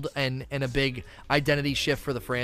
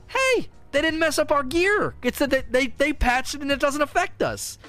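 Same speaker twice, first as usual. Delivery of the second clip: lightly voiced relieved player, higher; relieved gamer; 9 semitones higher than usual. PVE players relieved after the nerf, higher happy voice. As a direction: sound glad your gear survived.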